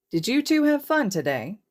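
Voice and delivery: put-on high, bright question to the kids; high, upbeat question to the children in the back seat; friendly question. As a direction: ask the kids kindly and brightly. Hizashi asks the kids.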